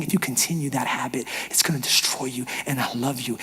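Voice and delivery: low, very soft, tender warning; whispering. Acted. God lovingly warning of a destructive habit, hushed near-whisper. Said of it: "He's whispering and saying".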